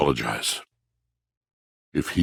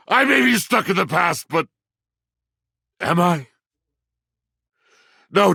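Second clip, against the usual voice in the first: much higher, upset and insistent; agitated protest; 11 semitones higher than usual. Acted. Denying being stuck in the past, high agitated protest. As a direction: defend yourself in a rising fluster. Indignant self-defense outburst.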